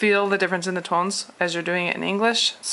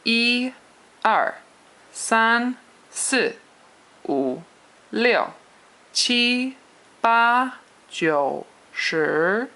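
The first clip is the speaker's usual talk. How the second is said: "一", "三", "七", "八" held high and level, "二", "四", "六" falling sharply; exaggerated Mandarin tones; 6 semitones higher, much slower than usual. Shown as a demonstration. Teacher drilling Chinese numbers for learners, exaggerated tones. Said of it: "Chinese pronunciation with the tones".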